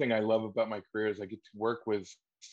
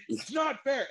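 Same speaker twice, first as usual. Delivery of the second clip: over-the-top wail; slower than usual. Acted. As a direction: raise the roof, overplay it. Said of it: "And I'm like".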